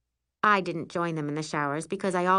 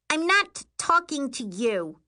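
high, cross little-sister retort; snapping; 8 semitones higher than usual. A narrator's reading. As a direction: snap back at your sister. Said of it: "Miri snaps".

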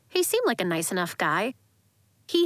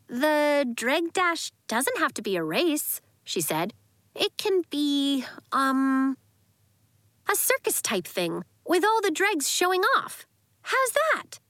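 put-on hopeful young girl voice; 5 semitones higher than usual. A narrator's reading. Nori pitching idea hopefully.

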